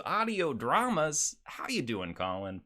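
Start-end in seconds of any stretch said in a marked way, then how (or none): none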